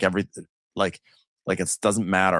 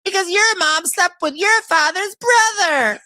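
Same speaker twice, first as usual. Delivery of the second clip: high, loud put-on voice; mock Portia; 6 dB louder than usual. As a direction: camp it up as the gossiping housewife. Voicing Portia's bombshell.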